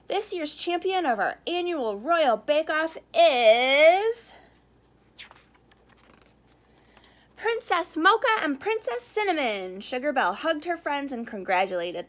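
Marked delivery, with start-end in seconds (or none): 0.1-9.8 winner announcement. Acted. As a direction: pause for suspense, then reveal.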